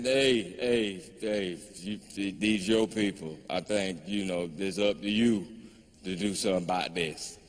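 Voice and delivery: low, soft voice of the whiskey; a low, quiet nudge from the drink inside; Jack Daniels. Acted. Whiskey urges comedian to deal with his people, low and soft. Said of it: "all that Jack Daniels I was drinking said".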